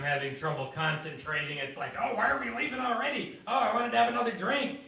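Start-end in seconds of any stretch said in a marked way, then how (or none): 2.0-4.8 fast, high whining protest; whiny drunk relatives. Retold or imitated. Drunk relatives complaining about leaving the party, high fast whine. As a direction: whine quickly like a tipsy guest.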